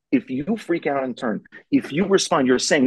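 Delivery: ordinary talk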